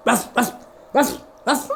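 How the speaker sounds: spoken ruff ruff barks; dog barking. Acted. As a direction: bark like a dog. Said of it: "The dogs began to bark".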